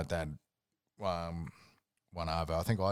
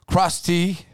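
Krusty called out high and loud; emphatic repeat; 9 semitones higher, 10 dB louder than usual. Real, not acted. Telling mate the shocking news, loud high emphasis. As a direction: hammer the name Krusty up high.

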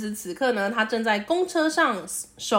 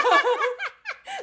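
sudden high-pitched laugh; a quick high laugh at the joke; high burst of laughter; 12 semitones higher than usual. Real, not acted. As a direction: squeal with laughter. Laughs at co-host's name joke.

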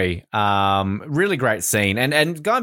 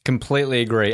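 ordinary talk, nothing marked

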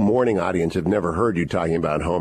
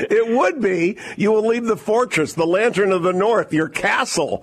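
high-pitched, laughing through the words; 12 semitones higher than usual. Real, not acted. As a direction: tease the guest through rising laughter. Host laughs at guest's demotion joke.

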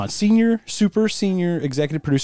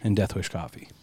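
hushed; 6 semitones lower, 8 dB quieter than usual. Pretend. Echoed hushed plug.

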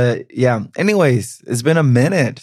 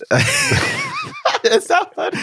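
laughing; much slower than usual. Real, not acted. Giggling at own dark joke.

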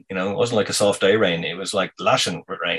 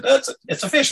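much higher, eager cry; excited insistence. Retold or imitated. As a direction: insist it's a fish, pitch up. Friend insisting fish on line.

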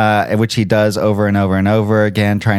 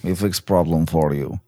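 light mock Russian mobster accent; broken English with a Russian lilt; 4 dB quieter than usual. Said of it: "doing the Russian accent".

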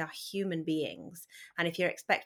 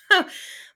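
short laugh; 14 semitones higher, 11 dB louder than usual. Real, not acted. Laughs about having tweens.

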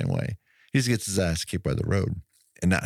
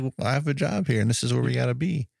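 mom; 7 semitones higher, faster than usual. Retold or imitated. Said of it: "I mean, Mom".

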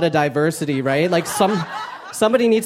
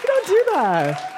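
high mock scolding; slower than usual. Real, not acted. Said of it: "comic aside, high".

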